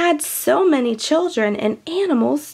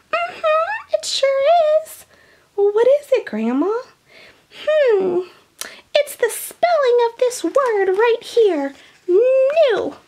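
put-on very high, squeaky old lady voice; Grandma puppet voice; 11 semitones higher than usual. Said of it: "Hey, Grandma".

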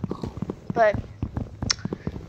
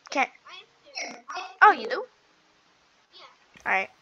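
normal talking voice

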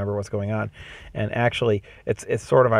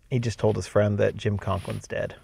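ordinary delivery

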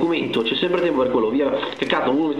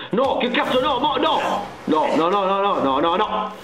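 panicked no's; 5 semitones higher than usual. Real, not acted. Catturato dal mostro.

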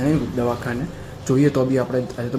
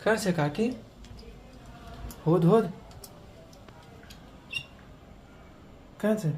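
high, coaxing searching voice; playful mock search; 6 semitones higher than usual. Playing hide and seek with a little child, high and coaxing. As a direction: pretend to hunt for auntie to thrill the child.

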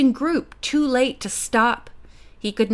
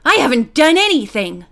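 louder, higher alarmed protest; protesting shriek; 4 semitones higher, 6 dB louder than usual. A narrator's reading. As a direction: protest your innocence loudly. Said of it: "the prisoner shrieked".

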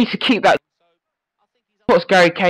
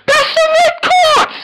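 much higher, cracking with outrage; outraged appeal. Real, not acted. Player protesting a foul to the ref, cracking outrage. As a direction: yell it at the ref like it's obvious. Demands a red card from ref.

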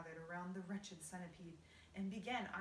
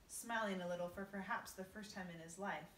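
usual speaking voice

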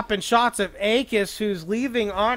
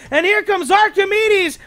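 excited call; 7 semitones higher, 5 dB louder than usual. Real, not acted. Caster building hype on a play.

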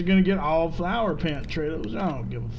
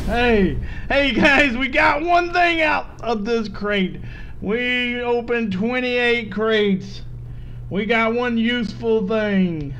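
calling out loud; 4 semitones higher, 5 dB louder, slower than usual. Real, not acted. Calling out to viewers.

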